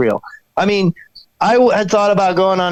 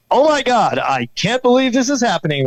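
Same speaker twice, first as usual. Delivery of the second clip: higher-pitched mock newsreader disbelief; news guy echoing him; 7 semitones higher than usual. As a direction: echo it as an amazed TV newsman. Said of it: "the guy in the news would be like".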